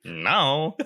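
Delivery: high, mimicked refusal; hammed-up game dialogue; reenacted game character. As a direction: imitate an odd voice-acted line. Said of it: "why did she say it like that".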